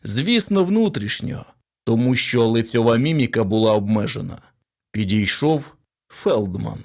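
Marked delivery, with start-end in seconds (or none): none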